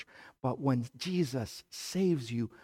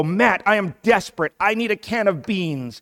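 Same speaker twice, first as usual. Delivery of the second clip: higher, louder desperate begging; loud pleading shopper; desperate plea; 7 semitones higher, 9 dB louder than usual. Retold or imitated. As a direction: beg for beans like it's urgent. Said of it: "you go".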